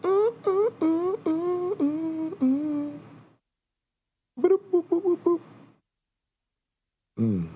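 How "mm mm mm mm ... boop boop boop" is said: very high cartoon hums then boops; playful high-pitched noises; silly squeaky humming and booping.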